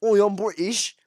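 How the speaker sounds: high, loud hammed-up British accent; mock British accent. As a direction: ham up a cockney Brit bragging about bad teeth. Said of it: "if I was like".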